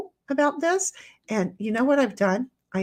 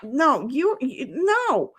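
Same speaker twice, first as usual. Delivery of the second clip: usual speaking voice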